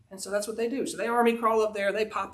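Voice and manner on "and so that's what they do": ordinary delivery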